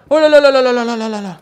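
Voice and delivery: fast, loud, panicky string of oh là là; panicked babble. Acted. Knight panicking as the dragon returns, fast and loud. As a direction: scramble to hide, gabbling in alarm.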